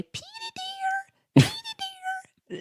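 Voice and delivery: great aunt calling the dog. Retold or imitated. Great aunt calling her little dog, high and screeching. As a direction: screech the dog's name like an old aunt. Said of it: "I can still hear her voice say".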